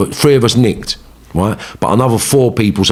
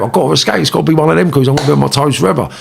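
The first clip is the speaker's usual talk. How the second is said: high, hurried inner monologue; retold agitated determination, pitched high; urgent resolve; 7 semitones higher, faster than usual. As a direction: talk yourself into it urgently. Retold urgent thought about escaping.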